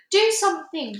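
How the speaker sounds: high, loud plea; cried. A narrator's reading. Sophie pleading with the BFG, high and alarmed. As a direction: beg in alarm. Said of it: "she cried".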